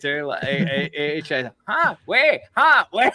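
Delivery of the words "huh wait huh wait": high, exaggerated Chinese-accented puzzlement; mock Chinese accent. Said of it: "I don't wanna do the accent".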